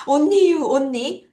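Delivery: "only" as onni, with no L; Korean-style pronunciation. Shown as a demonstration. Teacher joking about Korean habit, "only" said as onni. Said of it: "우리나라 말로 only 이렇게 하죠".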